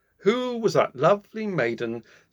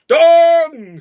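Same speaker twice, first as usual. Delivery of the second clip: long high loud bong; clock chime; 17 dB louder than usual. A narrator's reading. Clock striking midnight, a long loud bong. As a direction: ring it out like a big clock bell.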